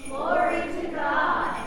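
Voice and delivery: distant group echo. Real, not acted. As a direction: say it back together. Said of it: "repeat after me".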